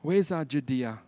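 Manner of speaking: normal talking voice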